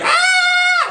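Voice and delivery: very high, piercing scream; imitated long shriek; loud mock scream. Brother opening door to ghost, terrified mock scream. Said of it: "like, this loud".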